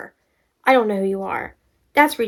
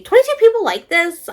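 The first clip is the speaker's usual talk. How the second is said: high, loud disbelief; surprised; 10 semitones higher, 6 dB louder than usual. Real, not acted. Disbelieving the likes on a review, loud and high. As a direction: gasp out the count in shock.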